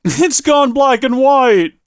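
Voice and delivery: slow, high, amazed laugh-cry; real disbelief as the screen turns grey; delighted shock, drawn out; laughing surprise. Real, not acted. Game suddenly turns grey.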